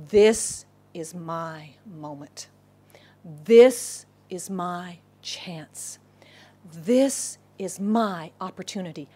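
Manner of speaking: very slow, low and weighty; slow intense realization. Acted. Blind beggar realizes his chance has come, slow intense delivery. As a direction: let each word land, hushed with resolve.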